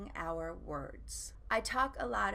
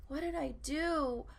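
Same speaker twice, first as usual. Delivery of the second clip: higher, louder mock dismay; hammed-up fretting in a raised voice; self-blaming panic; 5 semitones higher, 5 dB louder than usual. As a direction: act out panicked self-blame. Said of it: "So I thought, "I should blame myself".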